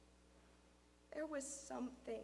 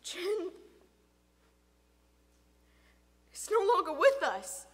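raised, strained grief; voice breaking; 7 semitones higher, 15 dB louder than usual. A narrator's reading. Father breaking news of daughter, voice breaking. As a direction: force the news out through grief. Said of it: "his voice broke".

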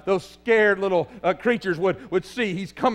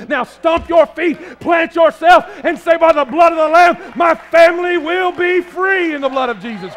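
full-force preaching; 7 semitones higher, 6 dB louder than usual. Acted. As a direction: command the crowd to stomp and declare. Shouted call to praise.

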